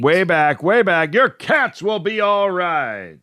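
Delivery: rising, excited play-by-play call; home run call. Pretend. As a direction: call it like the ball's leaving the park. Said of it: "this could almost be a home run call".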